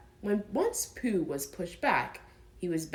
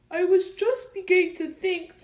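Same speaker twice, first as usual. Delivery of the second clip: sniffling; 12 semitones higher, 6 dB louder than usual. Acted. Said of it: "sniffing slightly".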